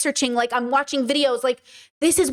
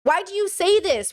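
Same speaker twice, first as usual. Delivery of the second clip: usual speaking voice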